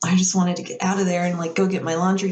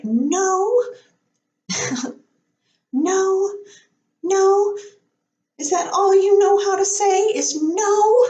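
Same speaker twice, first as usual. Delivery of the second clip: high, exasperated Disney princess mid-argument; Amy Adams; 13 semitones higher, 4 dB louder, slower than usual. Retold or imitated. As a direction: imitate a princess's indignant outburst, pitched way up. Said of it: "in an argument with the other main character".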